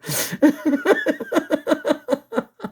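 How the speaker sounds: a long high burst of real laughter; high giddy laugh. Real, not acted. At the moon footage, a high, giddy real laugh.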